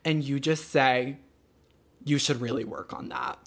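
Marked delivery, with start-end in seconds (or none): 2.0-3.4 imitated soft, low dig; mock-quiet criticism after a dead silence; understated put-down. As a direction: quietly drop a shady remark. Acts out partner's remark after silence.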